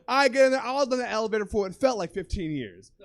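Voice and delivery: ordinary delivery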